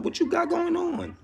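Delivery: high hammed-up welcome; mock greeting. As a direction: squeak it out like a friendly greeter. Acting out welcoming newcomers.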